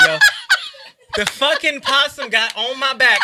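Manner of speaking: ordinary talk, nothing marked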